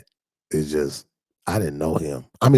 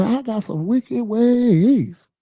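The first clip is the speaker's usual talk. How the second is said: high, loud, slow line for the congregation to echo; slow, high, loud call to repeat; preached for repeating; 6 dB louder, much slower than usual. Shown as a demonstration. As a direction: give it out for them to say back. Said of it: "Say it just like that".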